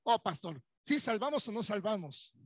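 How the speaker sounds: ordinary delivery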